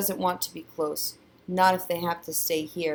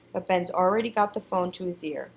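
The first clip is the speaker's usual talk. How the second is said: ordinary delivery, nothing marked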